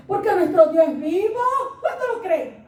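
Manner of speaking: loud, high joyful preaching, laughing; fervent exclamation. Real, not acted. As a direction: proclaim it to the church. (predicando con júbilo, fuerte y agudo)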